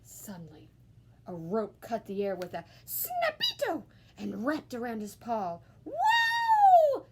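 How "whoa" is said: very high, loud shout; a kitten yelling while flying through the air; startled whoa. A narrator's reading. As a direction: yell as he is yanked off his feet. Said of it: "said Scipito as he flew".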